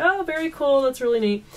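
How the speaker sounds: high, gushing but polite reply; a fan trying to act cool; mock star-struck politeness. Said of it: "I was like".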